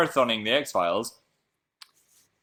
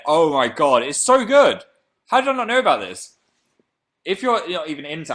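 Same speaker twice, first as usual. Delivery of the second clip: loud, high-pitched fan raving; gushing excitement; 7 semitones higher, 9 dB louder than usual. Real, not acted. Streamer raving to viewers about an anime, real excitement. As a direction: burst out with delight, voice leaping way up.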